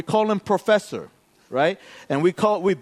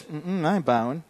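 low, soft refusal to bow; muttered refusal; 5 semitones lower, 7 dB quieter than usual. Retold or imitated. Guy at jiu-jitsu refusing to bow, muttered. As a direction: refuse quietly, keeping it to yourself. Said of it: "he's like this".

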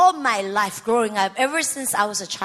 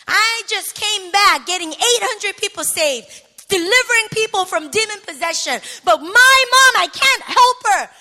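loud, high, escalating shout; angry, frustrated; 7 semitones higher, 9 dB louder than usual. Retold or imitated. Daughter who saves others but can't help mom, shouting angrily. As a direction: rage at God in helpless frustration. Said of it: "and I'm angry. I'm frustrated".

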